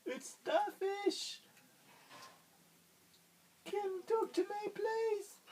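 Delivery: very high, slow squeaky pleading; very high small starfish voice; tiny starfish. Acted. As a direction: squeak slowly like a sad little starfish. Starfish at snail's door.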